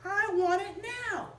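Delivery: slow, high, spoiled demand; demanding child. Acted. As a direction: stretch it out like a spoiled brat. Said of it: "She just said".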